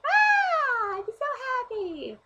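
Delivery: high, loud squeal of mock joy; fake ecstatic. Faking delight on the way to a shoot, squealing. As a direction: squeal as if overjoyed.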